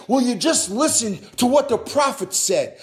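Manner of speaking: mild, acted cry of appeal, building in pitch; pleading cry. Preacher voicing God crying out to America, pleading. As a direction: plead insistently, each repeat pressing harder. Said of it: "he's crying and he's saying".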